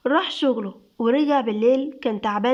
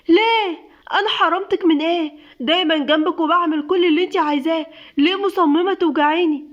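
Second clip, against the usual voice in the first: much higher, loud furious yelling; angry shout; 5 semitones higher, 4 dB louder than usual. Acted. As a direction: yell at her, hurt and furious. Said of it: "سيف بغضب وزعيق". (بغضب وزعيق)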